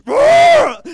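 huge high roaring shout; imitated lion roar. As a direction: roar out like a lion overtaken by the Spirit. Imitating his roar again.

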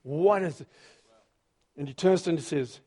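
ordinary talk

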